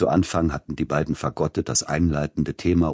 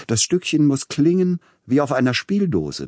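much higher and louder, urgent plea; imploring; 10 semitones higher, 5 dB louder than usual. A narrator's reading. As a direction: implore the musicians, voice leaping up. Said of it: "beschwor er die Musikanten". (beschwörend, hoch und laut)

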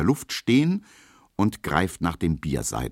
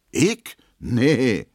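much higher, louder, offended denial; indignant; 6 semitones higher, 5 dB louder than usual. A narrator's reading. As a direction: bristle at the accusation. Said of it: "sagt Emma empört".

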